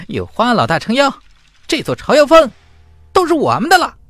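high, fast, gloating glee; gleeful villain. Acted. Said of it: "兴奋". (得意忘形地兴奋叫嚷)

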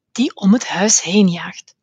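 plain reading voice

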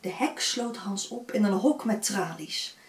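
usual speaking voice

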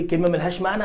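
ordinary talk, nothing marked

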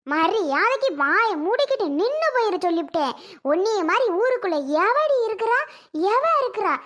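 high, loud furious telling-off; angry quarrel. Acted. Aunt angrily shouts down the girl in a quarrel. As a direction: tell her off furiously.

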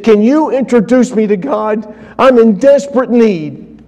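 pleading for rescue; high, loud begging; desperate plea. Retold or imitated. Manager begs for help.

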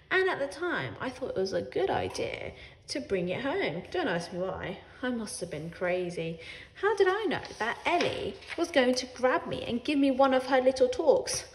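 ordinary delivery

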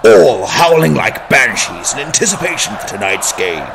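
loud, booming arena announcer hype; sports announcer. Acted. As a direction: pump up the crowd before kickoff.

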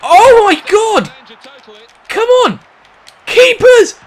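high, loud outraged yells; frustrated shouting. Real, not acted. Gamer rages at another save, high loud shouts.

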